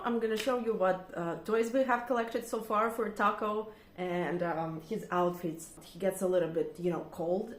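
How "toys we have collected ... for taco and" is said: ordinary talk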